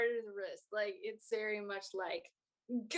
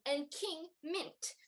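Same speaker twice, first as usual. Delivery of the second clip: royal announcer; 7 semitones higher, slower than usual. Acted. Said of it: "sorry, um".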